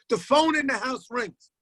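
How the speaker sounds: usual speaking voice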